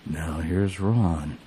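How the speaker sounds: really low, very slow drawn-out voice; Ron impression. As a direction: drop way down and drag the words. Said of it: "like, really low".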